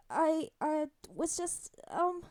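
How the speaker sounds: put-on awkward, drawn-out, halting kid; flustered stammering boy. A narrator's reading. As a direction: stumble for an excuse, slowly. Flustered boy caught staring, stammers.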